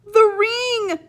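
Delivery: high, loud scream of alarm. Acted. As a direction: shriek it out in sudden panic. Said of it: "she screamed".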